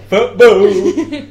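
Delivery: high, loud, drawn-out football chant; hammed-up fan shout; mock macho cheer. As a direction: bellow it like a sports lad. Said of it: "be like, "Rah, football".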